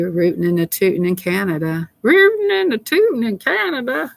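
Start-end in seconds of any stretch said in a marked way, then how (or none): 2.0-4.1 very high, held-out silly singsong; playful singsong. Pretend. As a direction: chant it way up high, goofing around. Repeats the phrase as playful singsong.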